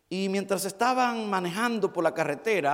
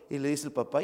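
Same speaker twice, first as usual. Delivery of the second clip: usual speaking voice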